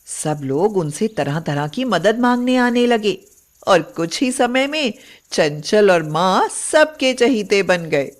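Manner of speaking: usual speaking voice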